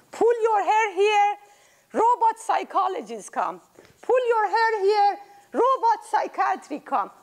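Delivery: put-on high child voice, instructing. Great-grandchild explaining the robot psychologist, high child voice. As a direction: explain like a helpful kid.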